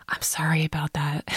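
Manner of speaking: soft whispered apology; sheepish whisper. Retold or imitated. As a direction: mumble it like an embarrassed kid. Daughter apologizes softly.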